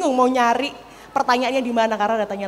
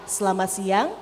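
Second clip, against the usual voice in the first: "selamat siang" low, rising at the end; low-key intonation; 4 semitones lower, 4 dB quieter than usual. Shown as a demonstration. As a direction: keep "selamat" low, tip "siang" upward. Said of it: "lekukan-lekukan sendiri". ("selamat siang" rendah, naik di akhir)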